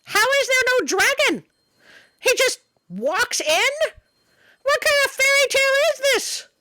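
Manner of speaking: high, loud outraged squeal of disbelief; indignant disbelief. Real, not acted. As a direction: cry out in comic outrage that there's no dragon. Outraged at missing dragon.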